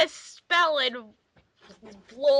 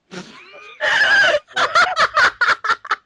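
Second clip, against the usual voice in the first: squealing cackle, much higher and louder; loud high shriek of laughter; 7 dB louder than usual. Real, not acted. Friends crack up at fanfic.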